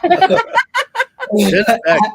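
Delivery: laughing through a high, stretched name; can't stop laughing. Real, not acted. Laughing mid-sentence.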